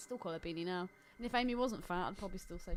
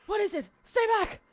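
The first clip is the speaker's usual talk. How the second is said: hammed-up panicked shout, higher and louder; mock terror; 9 semitones higher, 11 dB louder than usual. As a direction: cry out in pretend fright at a monster. Mock horror inside the scene.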